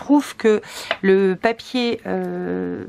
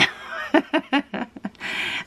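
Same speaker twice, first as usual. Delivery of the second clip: airy, unvoiced chuckle; breathy laugh; 5 semitones higher, 4 dB quieter than usual. Real, not acted. Vlogger admitting she is struggling, breathy laugh. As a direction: laugh breathily at your own effort.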